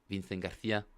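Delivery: Castilian Spanish accent with lisped c; name pronounced in a Castilian accent. Shown as a demonstration. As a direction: say it the Spain-Spanish way, "th" for c. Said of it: "if I have to say it with the accent".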